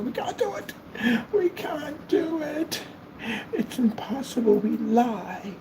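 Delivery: very high squeaky mocking voice; mock whiny excuse. Mocking a company that won't back things up, high whine. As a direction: whine it like a lazy clerk.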